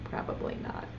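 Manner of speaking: voiceless whispered "Probably not.". Shown as a demonstration. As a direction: breathe it as a secret. Answers the question again.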